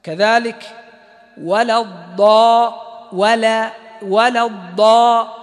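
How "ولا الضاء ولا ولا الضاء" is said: ولا الضاء slow, lam thin before heavy dad; light lam. Shown as a demonstration. Teacher modelling ولا الضاء for students, slow. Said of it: "لئلا تتأثر بالضاد".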